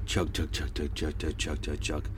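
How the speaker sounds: boat engine chug. Retold or imitated. Imitating passing boat engine.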